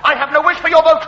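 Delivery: ordinary talk, nothing marked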